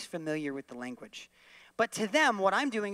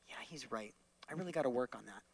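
sheepish agreement; 7 semitones lower, 11 dB quieter, faster than usual. Pretend. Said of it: "will go".

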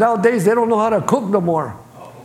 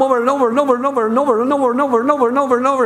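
rapid repetition; 4 semitones higher, much faster than usual. Shown as a demonstration. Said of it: "repeating over to show nagging".

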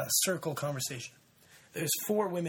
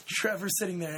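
usual speaking voice

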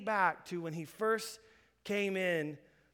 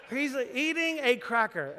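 laughing; 5 semitones higher than usual. Retold or imitated.

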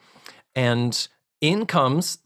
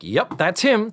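excited confirmation; 7 semitones higher than usual. Retold or imitated. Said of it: "they're like".

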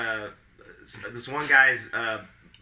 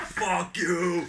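raised, drawn-out mock angry refusal; retold anger; 7 semitones higher than usual. Furious performer refusing to finish show, retold defiant cry. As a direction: re-enact a defiant curse, pitched up. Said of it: "And I was so mad".

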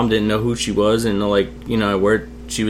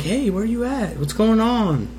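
put-on excited kid; 11 semitones higher, 4 dB quieter, slower than usual. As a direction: greet mom in a high, excited voice. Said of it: "I'm all excited".

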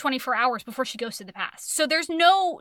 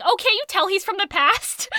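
high, loud, breezy mom voice; mock cheery mom; 6 semitones higher, 4 dB louder than usual. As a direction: toss it off like it's obvious. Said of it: "her mom is like".

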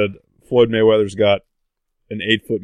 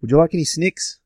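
put-on teasing New Zealand accent, pitched up high; mock Kiwi accent; 7 semitones higher than usual. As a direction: make fun of a Kiwi asking. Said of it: "making fun of the Kiwi".

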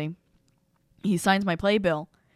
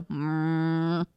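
a knowing murmur of approval; women in the audience humming along knowingly. Retold or imitated. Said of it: "all the women in the audience go".

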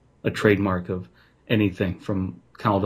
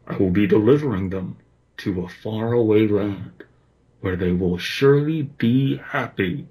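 muffled bear voice. A narrator's reading. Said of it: "The unmistakable muffled voice spoke up".

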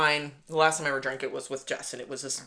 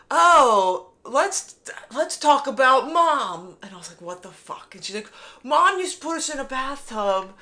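high-pitched mocking sister; exaggerated, raised-pitch taunting; imitated teasing; 7 semitones higher, 4 dB louder than usual. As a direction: mimic a drunk sister ribbing her sibling. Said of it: "kind of making fun of me".

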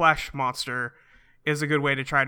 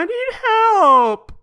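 mock little girl plea; 8 dB louder than usual. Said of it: "and she's like".

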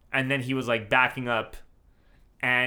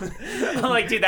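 laughing while talking; 11 semitones higher, 7 dB louder, much slower than usual. Real, not acted. Still laughing.

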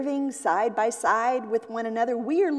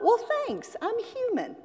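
much higher put-on shortstop voice; proud shortstop; 13 semitones higher than usual. Shortstop thanking a fan for a great play, much higher. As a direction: play the shortstop taking the compliment. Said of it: "Great play, shortstop".

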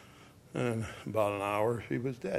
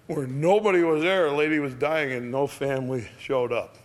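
ordinary delivery, nothing marked

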